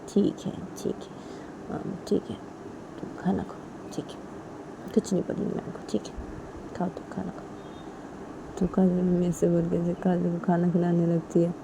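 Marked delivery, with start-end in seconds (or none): 0.1-8.6 very soft, near-whispered reassurance; hushed acted comforting; soothing mother. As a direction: give in gently, almost under your breath. Mother gives in, soothes daughter.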